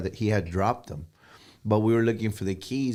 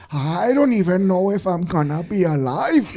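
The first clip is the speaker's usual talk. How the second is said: put-on high, loud old man laughing; 8 semitones higher, 6 dB louder than usual. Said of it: "I was ninety-eight years old".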